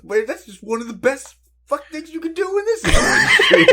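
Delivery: high-pitched imitation cracking up into laughter; hammed-up high voice, helpless with laughter; laughing impression. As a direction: push the quote out while losing it laughing. Acted celebrity quote, breaking into laughter.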